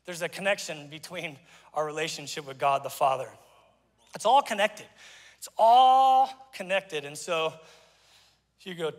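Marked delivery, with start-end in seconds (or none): 5.4-7.1 long, held "all", louder and slow; sustained "all" for emphasis; drawn-out ALL. Real, not acted. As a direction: stretch out "all" before landing on "connected". Repeats it, stretching the word all.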